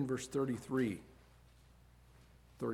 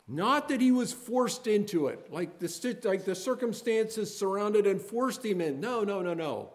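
loud, higher pleading why; impassioned preaching; 9 semitones higher, 8 dB louder than usual. Acted. Preacher asking the congregation why Jesus suffered, impassioned. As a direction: cry out each question of pain.

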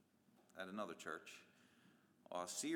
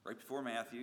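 ordinary talk

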